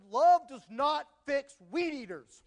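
slow, loud, exasperated husband's retort; frustrated retort. Retold or imitated. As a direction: drag it out, fed up with the broken weed eater. Frustrated husband snaps back.

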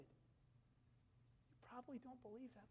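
breathy near-whisper, slow. Acted. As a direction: hush it to the child slowly. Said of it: "and he says".

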